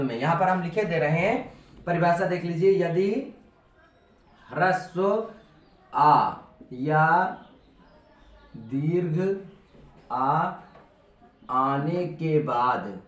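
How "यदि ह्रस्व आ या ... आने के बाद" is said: words spaced out with long pauses; slow dictation. Shown as a demonstration. Teacher dictating a rule to students, slow and spaced. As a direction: dictate slowly so students can write.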